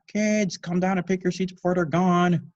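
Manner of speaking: high loud call upstairs; cried out. Acted. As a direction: summon the kids. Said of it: "Rita cried out".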